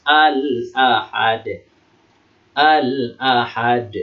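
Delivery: slow "Al-Ahad", the l held and fully sounded; clearly read lam. Shown as a demonstration. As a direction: recite "Al-Ahad" with every letter distinct. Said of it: "alifnya dibaca aja, jelas".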